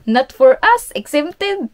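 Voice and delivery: high, loud mocking echo of husband. Wife mocks husband's excuse with a loud sneering echo. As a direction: sneer it back like a smug excuse. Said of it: "Sabi ba naman ni Bana na".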